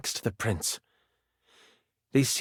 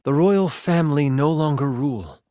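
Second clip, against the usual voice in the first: ordinary talk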